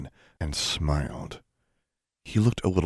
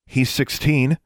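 pointed objection; 8 semitones higher, 4 dB louder, slower than usual. A narrator's reading.

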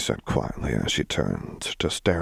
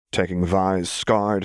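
ordinary delivery

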